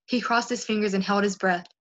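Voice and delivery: plain reading voice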